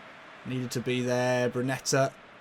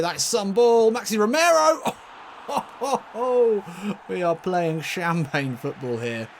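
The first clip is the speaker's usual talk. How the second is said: loud rising shout, then gleeful ho-ho; excited goal call; 7 semitones higher, 6 dB louder than usual. Real, not acted. Commentator hailing Romero's goal, excited goal call. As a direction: build to a high yell, settle into delight.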